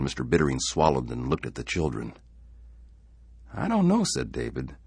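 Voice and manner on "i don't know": put-on much higher young boy, unsure; boy's voice. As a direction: voice a small worried boy. Young son David speaks.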